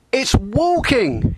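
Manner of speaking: high, loud, slow bellow. Acted. Skipper bellowing at his crew about the grounding. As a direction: roar it in exasperation. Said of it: "he bellowed".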